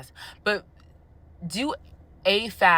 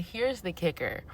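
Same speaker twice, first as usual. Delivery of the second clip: soft setup; 8 dB quieter than usual. Shown as a demonstration. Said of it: "repeated for emphasis".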